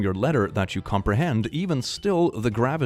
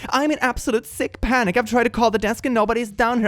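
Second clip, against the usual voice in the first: high, frantic worried mother; panicked mom voice; 10 semitones higher than usual. Retold or imitated. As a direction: read it like a panicking parent.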